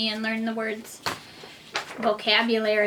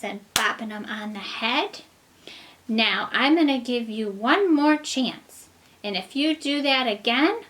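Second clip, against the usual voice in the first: fairy voice; 4 dB louder than usual. Acted. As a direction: scold like a stern fairy. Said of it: "and she said".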